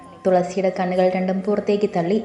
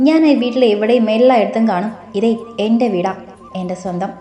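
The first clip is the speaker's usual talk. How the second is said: ordinary delivery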